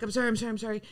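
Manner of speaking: soft, repeated apologizing; meek apology. Retold or imitated. Patient apologizing to nurses holding her down, soft meek apology. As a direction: apologize quietly, under the story. Retold apology while fainting.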